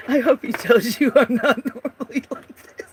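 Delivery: giggling while she talks; laughing through words. Real, not acted. Laughing at herself to the guests. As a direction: let the laugh spill into every word.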